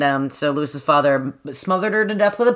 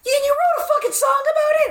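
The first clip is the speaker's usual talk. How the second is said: much higher, outraged disbelief; incredulous outrage. Real, not acted. Ranting to cohost about a song, high outraged disbelief.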